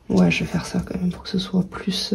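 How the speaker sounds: whispered aside to herself; whispering. Real, not acted. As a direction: breathe it, no voice. Aside while writing figures.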